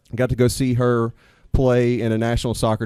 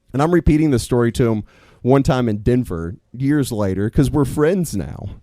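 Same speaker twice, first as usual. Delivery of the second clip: normal talking voice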